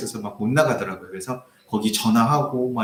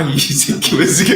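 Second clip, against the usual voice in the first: slow, louder, laughing mock swearing; cursing shout; 7 dB louder, slower than usual. Old man swearing at the pastor, laughing mock shout. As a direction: ham up the old man's cursing. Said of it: "하시면서".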